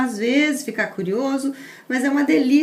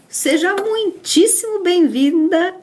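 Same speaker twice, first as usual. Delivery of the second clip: higher, louder and emphatic greeting; enthusiastic welcome; 4 semitones higher, 5 dB louder than usual. Real, not acted. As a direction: welcome the guest with real enthusiasm. Host formally welcomes the guest. (boas-vindas entusiasmadas)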